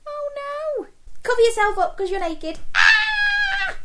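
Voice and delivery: high shocked shriek; mock shocked shout. Mock shock at naked crayon.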